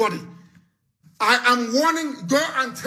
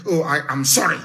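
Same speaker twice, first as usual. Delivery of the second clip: mock apology; 8 semitones lower than usual. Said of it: "come out ... and say".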